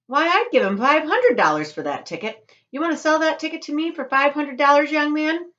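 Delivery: high, loud eager woman; eager outbidding. Acted. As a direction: push her offer over the crowd.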